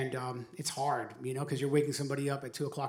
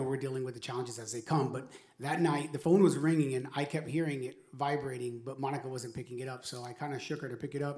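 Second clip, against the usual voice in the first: ordinary talk, nothing marked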